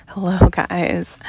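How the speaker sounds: usual speaking voice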